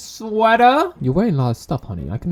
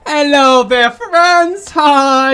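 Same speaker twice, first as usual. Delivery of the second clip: bright louder hello; cheerful greeting; 7 semitones higher, 5 dB louder, much slower than usual. Acted. Player greeting the animal friends, excited.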